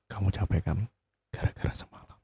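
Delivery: breathy suggestive whisper about Gara-gara semalam; a sly, intimate whisper; teasing whisper. Acted. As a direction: lean in and whisper it slyly. Suggestive teasing about last night.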